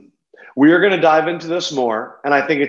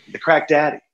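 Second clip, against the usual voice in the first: ordinary talk, nothing marked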